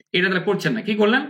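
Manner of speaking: normal talking voice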